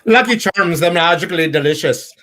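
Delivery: high-pitched cartoon Irish accent; hammed-up leprechaun-style Irish accent; bad Irish accent. Shown as a demonstration. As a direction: put on a very bad Irish brogue. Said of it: "my very bad version of an Irish accent".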